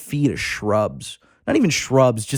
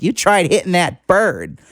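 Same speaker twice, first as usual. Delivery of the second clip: mock accusing woman; 6 semitones higher than usual. Woman accusing him, voiced.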